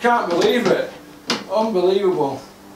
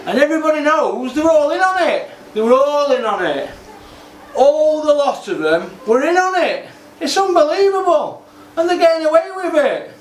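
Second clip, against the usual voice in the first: indignant outburst; 7 semitones higher than usual. Real, not acted. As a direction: pitch jumps way up, exasperated and ranting faster. Furious rant about pay-per-view.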